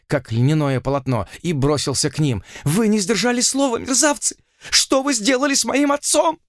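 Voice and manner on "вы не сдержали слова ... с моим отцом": put-on high, frantic young man's shout. A narrator's reading. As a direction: scream it at them, much higher. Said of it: "закричал он".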